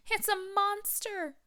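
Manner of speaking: high, slow mock moan; Mama Bear moaning. A narrator's reading. Mama Bear sees Goldilocks, high mock moan. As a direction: draw it out high and fearful. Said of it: "moaned Mama Bear".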